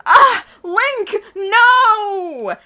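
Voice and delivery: high, loud mock-terror cries; hammed-up scream. A narrator's reading. Patient sees the villain at the bedside, hammed-up scream. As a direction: scream each word separately, stretching the last. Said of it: "I start screaming real loud".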